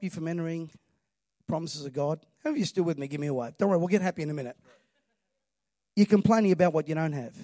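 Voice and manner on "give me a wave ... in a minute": ordinary talk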